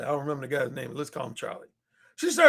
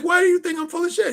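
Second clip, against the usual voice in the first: indignant woman; 10 semitones higher, 4 dB louder, faster than usual. Retold or imitated. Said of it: "she was like".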